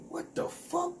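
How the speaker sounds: high, incredulous what the fuck; squeaky disbelief at a wild remark. Retold or imitated. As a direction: act stunned by what he said. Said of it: "I'm like".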